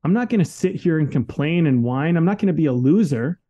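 a driven "just gotta be better" attitude; determined attitude. Retold or imitated. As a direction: refuse to lose, push yourself on. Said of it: "that attitude of like".

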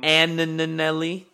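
"Annanelli" drawn out slowly, high and loud; mispronounced name. Retold or imitated. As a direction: exaggerate the misplaced emphasis in "Annanelli". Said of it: "I put the emphasis on the wrong syllable".

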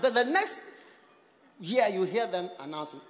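usual speaking voice

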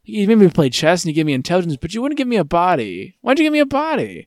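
rising to a high put-on whine; mock complaint. Scientist complaining to the clock, rising mock whine. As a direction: whine it up into a squeak. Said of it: "He's like".

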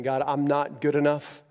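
a worn-out man begging God; Moses despairing. Acted. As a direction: Moses pleading with God, desperate. Moses voiced complaining to God.

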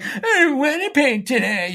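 high, loud whine through a laugh; whiny. Pretend. Imagined self refusing to paint, laughing whiny voice. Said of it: "I was really like".

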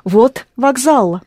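Vot Vokzal said slowly, clearly; slow model. Shown as a demonstration. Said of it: "How did you say".

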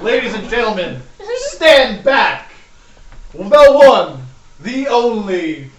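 loud hyped announcer call; ring announcer. Acted. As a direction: boom it out to the crowd. Announcing fighter to crowd.

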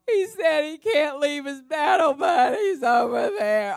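loud, high sobbing cry, slow; crying shout. Retold or imitated. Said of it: "She's bawling and squalling".

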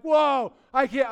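high, loud burst of mock astonishment; overwhelmed amazement. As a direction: exclaim as if it is all too much. Acts out amazement at heaven's wonders.

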